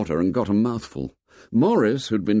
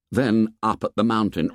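usual speaking voice

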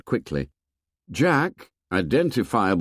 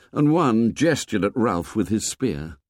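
ordinary delivery, nothing marked

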